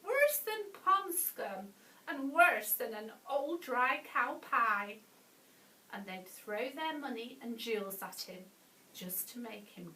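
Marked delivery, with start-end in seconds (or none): 0.0-5.0 raised, indignant passenger voice; disgusted shout. A narrator's reading. Passengers shouting at smelly Dirty Bert, disgusted. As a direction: shout the insult, pitched up and punchy. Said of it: "would hold their noses and shout".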